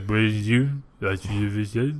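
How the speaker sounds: slurred, soft mumbling impression; low, garbled mock mumble; unintelligible mumble. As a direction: mumble it so nobody can make out a word. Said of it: "couldn't understand a fucking word Ryan Gosling was saying".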